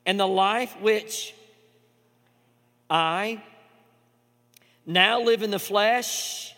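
measured reading, pausing on each I; slow scripture reading. Acted. Pastor reading Galatians aloud, slow and weighty. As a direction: read the verse deliberately, word by word. Reads the verse slowly, pausing.

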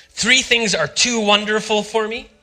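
measured scripture recital; scripture reading. Acted. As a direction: read the verse with reverence and wonder. Reads Proverbs aloud.

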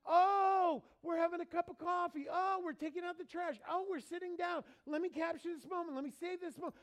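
high, excited mock gushing; imitating sister-in-law. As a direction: gush like a camera-happy relative. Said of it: "I have a sister-in-law who is relentless about taking pictures".

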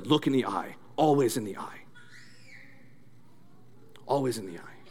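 low, quiet sensei instruction; hushed, unhurried master's correction; Mister Miyagi. Acted. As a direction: softly counsel like a karate master. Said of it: "and he says".

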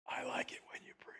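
soft breathy whisper of God. Retold or imitated. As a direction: breathe it like a secret. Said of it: "I heard a whisper come back".

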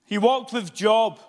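high, loud pulpit preaching with shouted peaks; fiery revival preaching; preaching at full force. Acted. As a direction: thunder it from the pulpit. Preaching climax.

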